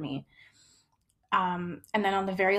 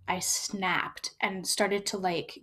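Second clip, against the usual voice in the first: ordinary talk, nothing marked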